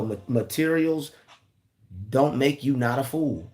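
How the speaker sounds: ordinary talk, nothing marked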